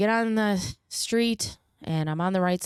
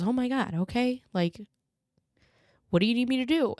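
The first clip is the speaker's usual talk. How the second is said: higher-pitched, imitating a worried grandmother; retold alarmed concern; 7 semitones higher than usual. Grandmother answering news of a nervous breakdown, retold alarmed concern. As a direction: voice her alarm, pitch lifted.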